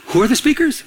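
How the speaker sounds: retold eager about-face; suddenly interested. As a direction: perk up with comic interest. Sudden interest after hearing about women.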